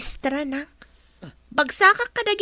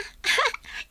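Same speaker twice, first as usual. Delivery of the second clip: giggle; 8 semitones higher, 5 dB quieter than usual. Acted. Daughter delighted by new dress.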